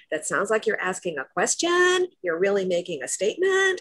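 rising inflection on QUESTION and STATEMENT, as if asking; uptalk. Shown as a demonstration. Coach demonstrating a rising inflection to listeners, uptalk. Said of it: "that's a rising inflection".

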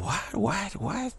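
high astonished "what?"; mock bewilderment. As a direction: act baffled innocence.

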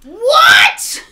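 high loud cry of disbelief; shocked shout. Retold or imitated. Reacting to the father's song, high shocked shout. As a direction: shriek it in outrage. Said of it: "And you're like".

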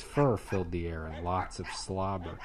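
ordinary delivery

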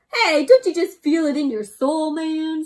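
lightly voiced chill creature; hug-loving buddy; laid-back Cubby. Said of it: "says Cubby".

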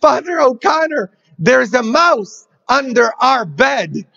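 high, shouted mock panic; all frantic. Housekeeper bursts into the dining room, hammed-up frantic. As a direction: burst in crying out to Father in a fright. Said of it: "says, all frantic".

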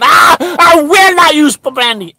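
self-correcting yell, pitch jumps high; a sudden loud self-censoring outburst; sudden shout. Retold or imitated. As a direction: blurt it out loud then rein it in. Catches himself mid-swear.

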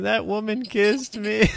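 high, slow whiny complaint; high, slow mock whine; mock complaining man. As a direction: whine it like a wounded grown man. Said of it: "Can you imagine a, a man, you know, complaining".

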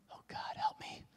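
breathy whisper under the breath; a desperate prayer whispered to himself. Retold or imitated. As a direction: pray it silently, barely voiced. Silent prayer inside his head.

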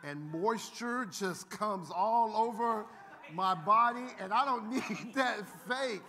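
slow, drawn-out know-it-all coworker, breaking into laughter; mock preachy coworker. Pastor mocking a coworker who shuns lotion, slow and laughing. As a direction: stretch his boast out, laughing through it. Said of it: "He said".

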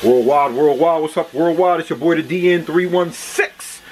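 high, loud hype-man greeting; hyped intro. Acted. Greeting his worldwide viewers as a hyped-up host. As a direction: call out to the whole world, pumped up.

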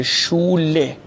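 شوله with the closing e fully sounded; final e pronounced. Shown as a demonstration. Teacher shows learners that final e is read, شوله with its e sounded.

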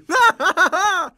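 loud high gloating laugh; taunting laugh. Acted. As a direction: laugh after dodging the hit. Boss dodges and laughs.

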